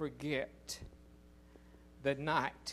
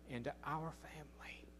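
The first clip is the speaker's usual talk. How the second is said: whispered; 11 dB quieter than usual. Acted. Tender aside in sermon.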